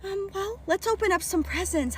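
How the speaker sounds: high, uneasy cheer; nervous diversion. Retold or imitated. Party princess changing the subject with a child, high nervous cheer.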